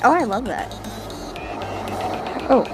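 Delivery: normal talking voice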